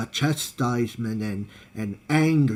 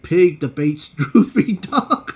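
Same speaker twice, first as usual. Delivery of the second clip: louder, higher, breaking into laughter; laughing while reading; 4 semitones higher, 5 dB louder than usual. Real, not acted. Reading a funny comment title to a friend, laughing. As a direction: read it out through rising laughter.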